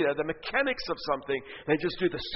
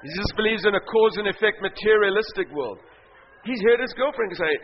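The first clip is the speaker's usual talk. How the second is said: ordinary talk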